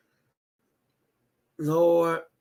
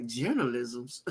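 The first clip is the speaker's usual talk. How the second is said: hushed disbelief; 7 semitones lower, 9 dB quieter, slower than usual. Retold or imitated. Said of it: "I was like".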